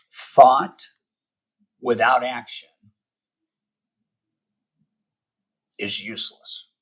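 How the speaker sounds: slow, emphatic, with long pauses between phrases; really slowly. Real, not acted. Coach stating a key principle to listeners, really slowly. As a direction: land each phrase separately, taking your time. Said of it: "I gotta say this really slowly".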